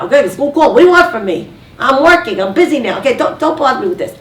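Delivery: acted busy husband snapping; irritated husband. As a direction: fend off the caller, speeding up at the end.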